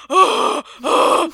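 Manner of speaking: loud panicked gasping cries, mock drowning. Retelling gasping out of the pool at the end. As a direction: gasp for air like a drowning kid.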